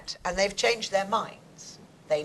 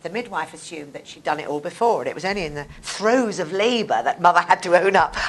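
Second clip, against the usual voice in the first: ordinary talk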